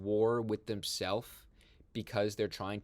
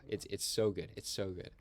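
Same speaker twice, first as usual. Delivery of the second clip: ordinary delivery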